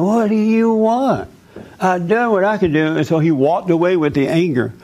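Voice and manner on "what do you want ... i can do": high, strained mother's cry, half-hearted yell; a mother snapping back at her son, higher and far-off; mock screaming mother. Caller's mother yelling at her son, mock high yell. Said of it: "she would start screaming and yelling".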